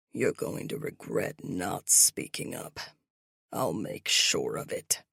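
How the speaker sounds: low villain threat; malicious threat. Acted. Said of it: "His tone drips with malice".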